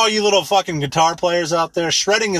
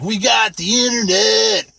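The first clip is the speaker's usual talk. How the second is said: mock lyric; 5 semitones higher, slower than usual. Mocking [name]'s lyrics.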